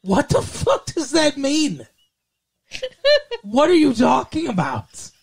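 high, slow, laughing disbelief; incredulous. Real, not acted. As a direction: drag out the disbelief, half laughing.

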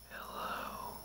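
a faint voiceless whisper. Retold or imitated. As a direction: barely breathe the greeting. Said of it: "he said it whispered again".

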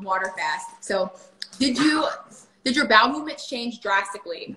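usual speaking voice

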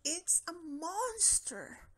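high, slow fearful wail; frightened cry. Acted. Scared cat spots the monster, slow high wail. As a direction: wail it out, terrified.

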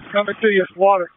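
calling out loud over the fireground noise. Real, not acted. Commander to crew, calling out loudly that water is coming. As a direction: shout it into the radio.

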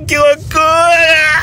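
high, slow thrilled shout; excited shout. Real, not acted. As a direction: yell it out in disbelief. Thrill of the crazy drive.

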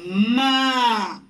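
"Ma" stretched out for learners; sílaba modelo. Shown as a demonstration. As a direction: hold the vowel of "Ma". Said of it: "¿Qué sílaba?". ("Ma" alargado, como modelo)

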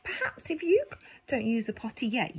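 plain reading voice

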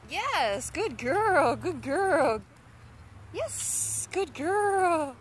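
high, sing-song praise for a dog. Real, not acted. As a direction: gush over a good puppy.

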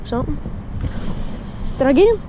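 usual speaking voice

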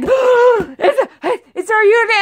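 husband woken in alarm, high and loud. Retold or imitated. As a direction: burst out in fright. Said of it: "And he's just like".

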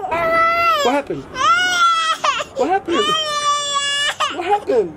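high, slow, drawn-out wail of a question; exasperated cry. Real, not acted. Baby crying, desperate dad.

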